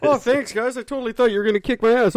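high, fast mock relief; relieved victim. Mock victim thanking gang.

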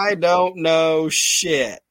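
a dumb tough guy drawling his line; a lightly voiced big brute; mock Sid line. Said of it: "just says".

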